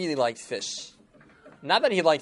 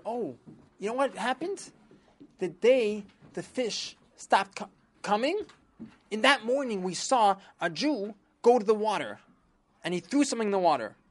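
fishermen voice; 7 semitones higher than usual. Acted. Fishermen telling minister a tip.

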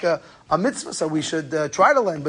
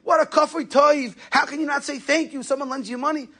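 high-pitched mock outrage, no real volume; acted indignant rebuke, voice pitched well up; retold yelling; 8 semitones higher than usual. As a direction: scold like an indignant rabbi, imitated. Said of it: "Reb Yerucham started yelling at him".

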